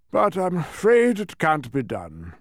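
drawn-out royal refusal; regretful king. A narrator's reading. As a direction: declare it regretfully.

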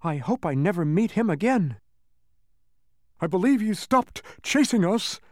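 high-pitched humbug voice; anxious bug character. Acted. Bug to companions about the chaser, high-voiced relief.

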